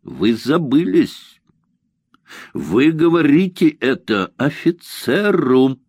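slow, stern rebuke; officer's strict cold reprimand; sternly. Acted. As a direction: put him in his place. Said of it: "строго сказал Мандр".

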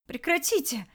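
high cry that cracks; crying boy begging the bandits to stop; voice breaking. Acted. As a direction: let the voice crack on it. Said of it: "Голос сорвался".

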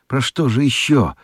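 ordinary talk, nothing marked

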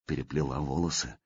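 soft, quiet tender question; gently asking. A narrator's reading. Slavka tenderly asks the princess, soft and quiet. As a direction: ask her softly, close and caring. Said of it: "спросил ласково".

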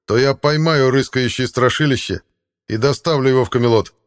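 high, loud heroic vow; manly heroic voice. A narrator's reading. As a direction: ring out like a battle horn. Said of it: "подобно звуку боевого рога". (мужественно, как боевой рог)

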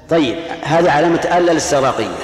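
usual speaking voice

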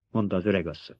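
ordinary delivery, nothing marked

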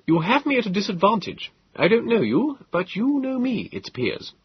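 high, wary, puzzled gentleman; unsettled by a stranger who knows you; puzzled host. Acted.

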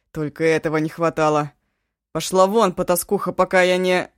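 loud raging command; furious yell. Acted. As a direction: roar her out of the room. Eshu drives the witch out.

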